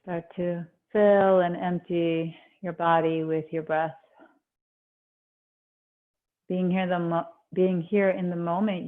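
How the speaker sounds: calm meditation voice, long pauses between phrases; guided meditation. Acted. Yoga teacher easing students into breath awareness, guided meditation. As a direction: settle them gently inward, leaving space after each instruction.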